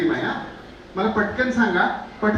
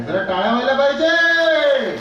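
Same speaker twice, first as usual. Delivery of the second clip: loud, high, drawn-out crowd call; calling for applause; 4 semitones higher, 5 dB louder than usual. Real, not acted. Host asks the audience to clap, long rallying shout. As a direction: stretch it out to whip up the audience.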